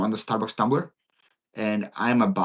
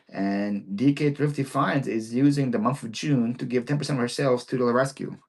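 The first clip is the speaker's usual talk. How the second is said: normal talking voice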